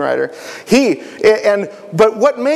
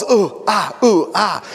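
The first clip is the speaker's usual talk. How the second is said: tumbling groans; 3 semitones higher than usual. Acted. Masked man tumbling down hill.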